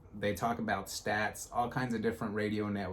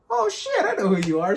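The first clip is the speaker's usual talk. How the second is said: much higher and louder, thrilled shout; excited recognition; 11 semitones higher, 11 dB louder than usual. Retold or imitated. Podcaster recognizing a Twitter follower, excited shout. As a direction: blurt it out, starstruck and delighted. Realizes who the fan is.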